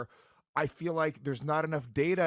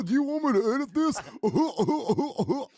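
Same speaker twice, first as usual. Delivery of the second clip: high, loud teasing mock voice; squeaky taunting impression; 10 semitones higher, 6 dB louder than usual. Mocking co-host in silly voice.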